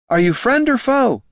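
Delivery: very high, loud call. A narrator's reading. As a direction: shout the question into the trees. Calling into the swamp.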